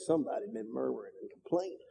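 muttered aside. Acted. Wry aside to congregation.

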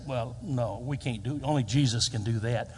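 low, softly voiced excuse; doubtful objection. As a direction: back off meekly, voice dropped. Voicing a doubting listener.

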